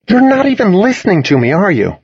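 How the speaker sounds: high-pitched, put-out complaint; complaining. A narrator's reading. Toby to a friend lost in thought, complaining. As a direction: whine it at someone who has tuned out. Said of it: "Toby complained".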